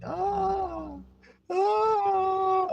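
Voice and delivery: long, very high crying wail; imitated child's scream. Father imitating his son crying in the car, imitated high wail. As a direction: mimic a screaming child, held on one high note. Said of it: "He's just screaming".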